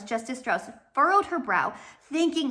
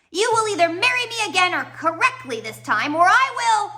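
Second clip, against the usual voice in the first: higher and louder threat, rising to a yell; menacing shout; 10 semitones higher, 7 dB louder than usual. Acted. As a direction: bark the threat into the walkie-talkie. Villain threatens over walkie-talkie.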